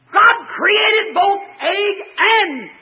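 very slow, high, loud preacher's wail; crying preaching shout. Real, not acted. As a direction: stretch each word in a tearful cry. Preaching at full force.

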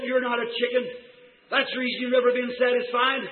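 ordinary talk

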